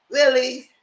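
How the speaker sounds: high, loud, put-on "Really?"; incredulous really. Real, not acted. Speaker mocking Keandre's news, high incredulous "Really?". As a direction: sell the disbelief on "Really?". Speaker's own shocked reaction.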